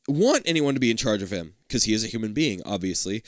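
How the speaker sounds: ordinary delivery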